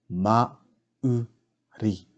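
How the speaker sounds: "Mauri" slowly split as Ma, u, ri; slow teaching breakdown of "Mauri"; broken into syllables. Shown as a demonstration. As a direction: sound out "Mauri" with a gap after each part. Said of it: "break down the words first".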